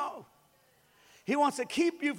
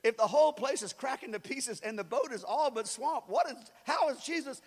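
usual speaking voice

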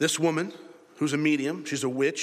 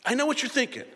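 ordinary delivery

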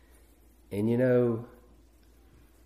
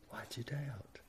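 voiceless whisper, much softer; 17 dB quieter than usual. Acted. The Holy Spirit to a doubting believer, whispered. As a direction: breathe it like an inner voice.

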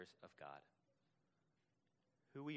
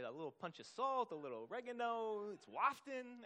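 put-on savoring New York Italian accent, rising high; thick New York Italian accent; 10 semitones higher, 4 dB louder than usual. Preacher imitating his friend on pasta sauce, New York Italian accent. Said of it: "He used to have this thick accent".

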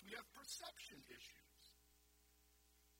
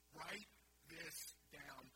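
each word drawn out, quieter, slow; "Write this down" said very slowly and softly, word by word; slow, deliberate repeat; 2 semitones lower, 3 dB quieter, much slower than usual. Shown as a demonstration.

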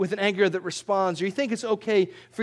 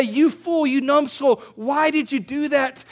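louder, higher acted outburst; lashing out angrily; 6 semitones higher, 5 dB louder, slower than usual. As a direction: scold a coworker in mock anger. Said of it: "you wanna lash out in anger".

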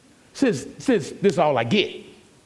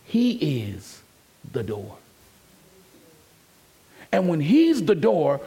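normal talking voice

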